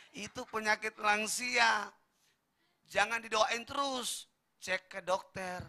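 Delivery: slow, loud, every word pressed down; emphatic punchline. Acted. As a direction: hammer the point home slowly. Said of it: "repeated punchline, slow and loud". (tegas dan lambat, tiap kata ditekan)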